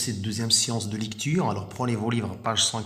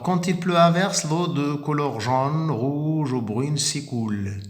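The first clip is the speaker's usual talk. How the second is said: model reading; 3 semitones higher than usual. Shown as a demonstration. Teacher reading the paragraph aloud again for pupils. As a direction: read it out as the example for the class. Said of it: "lire le premier paragraphe individuellement".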